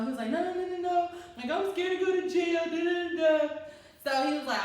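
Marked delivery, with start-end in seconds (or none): 0.3-3.5 high, loud sing-song panic; mock-frightened babble, higher and louder; panicked boyfriend. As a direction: mimic a frantic, scared man. Scared boyfriend voiced in panic.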